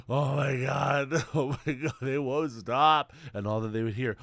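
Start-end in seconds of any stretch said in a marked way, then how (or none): 0.0-3.1 slow, sighing, worn-out; exhausted zebra. Acted. Collapsed zebra despairing about the hunters, slow and drained. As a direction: sigh it out like you're totally spent. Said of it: "It's laid down on the ground".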